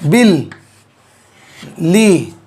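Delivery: बिल ... ली broken into two beats with a pause; split into syllables. Shown as a demonstration. Teacher spelling out a word for students, syllable by syllable. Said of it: "दोनों लाम को एक कर दिया".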